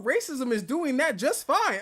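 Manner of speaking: ordinary talk, nothing marked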